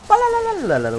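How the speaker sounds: gunfire imitation.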